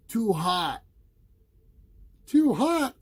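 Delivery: high, loud disbelieving mother; mother's exclaim. A narrator's reading. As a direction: repeat it in disbelief. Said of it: "cried his mother".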